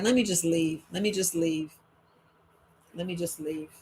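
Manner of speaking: low, fading let me just leave; resigned retreat. Retold or imitated. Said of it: "and I was like".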